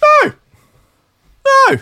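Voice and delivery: high, loud, hammed-up denial; defiant mock shouting. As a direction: build to high shouted protests. Acting out a defendant's defiant excuse.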